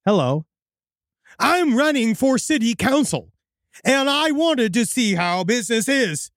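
high, slow, oddly formal voice; weird stilted stranger. Acted. Odd council candidate quizzing a store clerk, high stilted weird voice. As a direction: stretch the words as if speech is new. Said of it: "in a weird accent".

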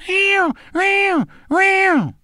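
high squeaky cat meows; cat meowing. Retold or imitated. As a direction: meow like a small cat stuck in the house. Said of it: "and Buster in there going".

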